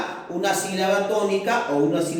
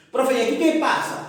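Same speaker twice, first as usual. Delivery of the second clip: a higher, quick student voice asking "Profe"; eager student question; 6 semitones higher, faster than usual. Acted. Teacher voicing a pupil who asks the profe, quick and higher. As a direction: jump in as a curious pupil.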